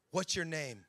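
low, hushed, portentous imitation; mock a mysterious movie seer; dramatic prophetess voice. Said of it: "Why are you talking in that voice".

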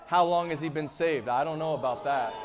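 low-pitched mock hesitation; wary churchgoer. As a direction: imitate someone doubtful and suspicious. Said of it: "We'd stumble over that".

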